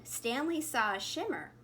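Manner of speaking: plain reading voice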